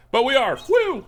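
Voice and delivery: high, loud burst of triumph; excited and celebratory; gleeful cheer. Real, not acted. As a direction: crow it, ending in a high whoop. Celebrating a type advantage in game.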